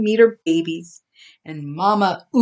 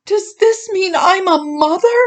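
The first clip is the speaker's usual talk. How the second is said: mild whimper; 11 semitones higher than usual. A narrator's reading. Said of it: "she whimpered".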